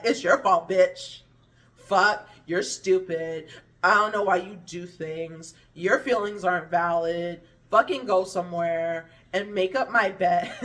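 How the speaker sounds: loud, mock family tirade; imitated harsh scolding. Family dismissing her feelings instead of comforting, mock berating. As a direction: scold them loudly, as a hammed-up imitation.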